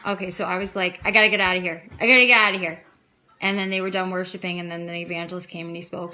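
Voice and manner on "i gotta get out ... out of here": louder, emphatic repeated thought; retold urgency. She thinks of fleeing the worship service, retold loud urgency. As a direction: act out wanting to flee. Retells urge to flee the cult.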